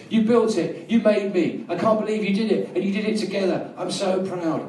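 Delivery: high, proud motherly voice; a mother's voice, lifted and proud; mother's voice from the car. Retold or imitated. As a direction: sing it out like a proud mum. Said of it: "She goes".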